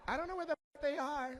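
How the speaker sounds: high-pitched mock panic; exasperated partner. Lover answering the man who wants his headphones, mock exasperation.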